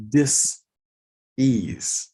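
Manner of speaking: dis-ease said slowly as two parts, dis then ease; split dis-ease. Shown as a demonstration. Health speaker explaining the body not at ease, splitting dis-ease slowly. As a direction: pull dis-ease apart into dis and ease. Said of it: "I didn't say disease, I said dis-ease".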